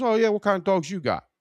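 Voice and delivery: high, dopey customer voice. Retold or imitated. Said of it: "He goes".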